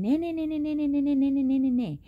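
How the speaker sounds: high, mocking sing-song nah; imitating the girl yelling back. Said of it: "she's still yelling".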